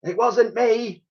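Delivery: very high, slow innocent protest; Dotty protesting innocence up on the roof; Dotty calling. A narrator's reading. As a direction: play innocent. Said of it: "called Dotty".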